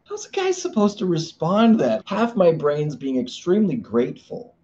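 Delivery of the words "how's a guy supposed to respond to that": higher, louder, mock-exasperated guy voice; baffled husband. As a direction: throw up your hands, half joking. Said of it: "And I'm all".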